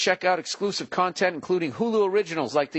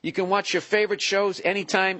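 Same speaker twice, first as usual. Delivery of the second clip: ordinary talk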